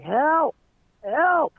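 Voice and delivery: high, loud repeated cry of help; mock scream for help. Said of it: "this loud screaming".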